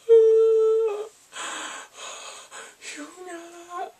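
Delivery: high, wailing mock sob of her name; mock crying. Recalling his lost love, he wails her name in a high mock sob. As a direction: ham up a weeping wail. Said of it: "He cried in nineteen ninety-nine".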